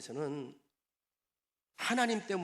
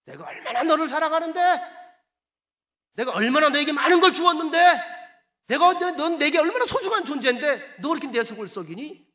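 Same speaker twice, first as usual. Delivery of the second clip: high, very loud, anguished outcry in God's role; impassioned shouted reproach, pitched way up; heartbroken God pleading; 10 semitones higher, 13 dB louder than usual. Retold or imitated. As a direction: cry out in wounded love, acting God's reproach. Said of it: "나 가슴 아프게 하지 마".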